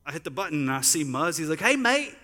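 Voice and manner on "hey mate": high, loud Australian greeting; Australian accent. Retold or imitated. Australian friend greeting him on FaceTime, put-on Australian accent. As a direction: put on a cheery Australian mate's voice. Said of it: "in my best Australian voice".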